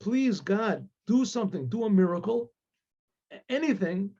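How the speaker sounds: acted father's mounting, imploring cry to God; desperate plea. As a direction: beg heaven for help, voice rising toward tears. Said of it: "And he starts crying".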